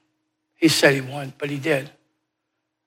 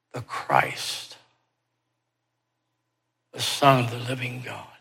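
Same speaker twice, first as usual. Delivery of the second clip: near-whispered, awed confession; a soft, reverent whisper of faith; hushed awe; 4 semitones lower, 8 dB quieter than usual. Acted. Peter's awed confession of Christ.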